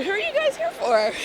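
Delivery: high mock fan question; playful acted quote. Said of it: "I was like".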